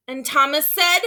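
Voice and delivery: ordinary delivery, nothing marked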